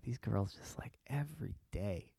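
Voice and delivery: whispered private complaint; soft, hushed muttering to himself; thinking it. Acted. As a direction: whisper the grievance as an inner thought. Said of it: "He's thinking it".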